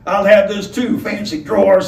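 lightly voiced menacing robber; a hint of a gruff robber, easy threat. Robber grabs the cravat.

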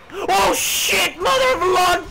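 high, loud alarmed cry; startled shout. Real, not acted. Player caught off guard, shouting in alarm. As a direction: yelp in surprise and fear. Sudden attack in game.

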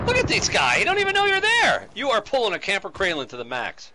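high, loud, amazed hollering; excited. Real, not acted. Father cheering on a camper in the game, excited hollering. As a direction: crow over it at full voice.

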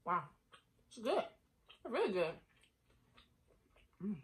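talking while chewing; muffled by food in mouth; mouth full, chewing. Real, not acted. Seen 1: Lips closed, cheeks working while speaking; plate of tacos in front.

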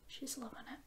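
a soft breathy aside; whisper. Real, not acted. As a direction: whisper so the dog stays calm. Petting the dog.

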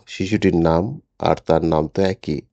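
plain reading voice

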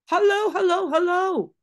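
high, loud sing-song hello; calling parrot voice. Acted. Teacher voicing the voice heard again. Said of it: "La voix venait encore".

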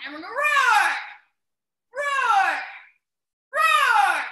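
loud, high roar calls; roaring shout. Real, not acted. Coach roars along with the class, shouting.

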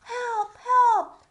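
high loud cry for help. Acted. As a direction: shout it out like a panicked page. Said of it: "cried the page".

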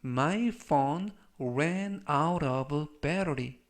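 very slow, measured words; slow model reading. Shown as a demonstration. Teacher models the phone battery sentence for learners, slow model reading. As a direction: give every word time, as for beginners.

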